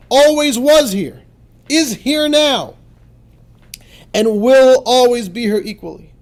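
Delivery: fervent proclamation. Shown as a demonstration. Reciting the script fervently.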